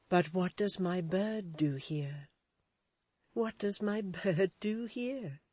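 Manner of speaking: slow, tender, fond question. A narrator's reading. Edith embracing Florence, speaking tenderly. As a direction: soften and cradle the words. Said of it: "she said in a tender manner".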